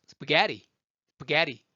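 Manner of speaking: 스파게티 as "spuh-GEH-ree" with tapped T; American-stressed spaghetti for 스파게티. Shown as a demonstration. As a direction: punch 게 and rush past 스파. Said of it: "이의 액센트가 있었어요".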